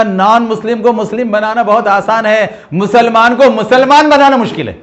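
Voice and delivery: loud, high preaching of the key line; emphatic proclamation. Retold or imitated. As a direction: ring it out as the sermon's point. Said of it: "انہوں نے بہت صحیح بات کہا". (زور دے کر بلند آواز میں اعلان)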